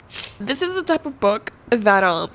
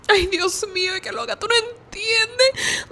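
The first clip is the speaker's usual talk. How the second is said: exasperated; 13 semitones higher than usual. Real, not acted. Overwhelmed exclamation in Spanish.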